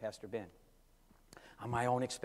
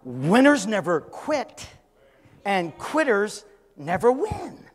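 slow proclaimed saying; 6 semitones higher, 9 dB louder, slower than usual. Retold or imitated. Quoting the saying he preached.

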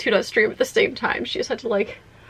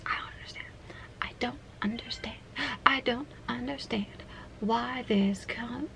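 despairing whisper; 13 dB quieter than usual. Acted. Streamer baffled by her camera, despairing whisper. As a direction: whisper it haltingly, as if utterly baffled.

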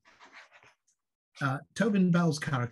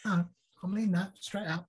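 much higher-pitched put-on voice; Harvey Stevens impression; 7 semitones higher than usual. Showing friends how the actor talks, high-pitched imitation. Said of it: "talk like that".

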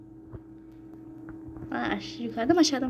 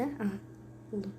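normal talking voice

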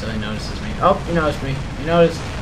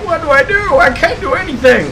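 high mock panicked voice, higher; panicked enemy; 13 semitones higher, 6 dB louder than usual. Voicing the helpless enemy player, high mock panic. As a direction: squeak it like a helpless victim. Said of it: "he's like".

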